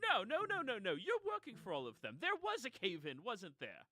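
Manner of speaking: flustered denial. Acted. Noble woman fending off the shouting fat man, flustered.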